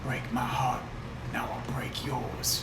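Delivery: whisper. Acted. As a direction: breathe it into her ear. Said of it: "And I whisper in her ear".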